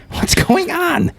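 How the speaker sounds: very high, drawn-out squeal; mock bewildered cry. Himself asking the caller, very high mock bewilderment. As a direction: stretch it out in exaggerated falsetto bewilderment. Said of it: "And I'm like".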